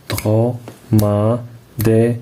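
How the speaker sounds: slowly syllabified. Shown as a demonstration. Teacher spaces out syllables for the pupils. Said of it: "écris le nombre de syllabes".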